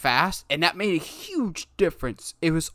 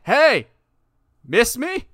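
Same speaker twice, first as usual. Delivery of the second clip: cocky taunt; 7 semitones higher, 8 dB louder, much slower than usual. Retold or imitated. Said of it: "he's just like".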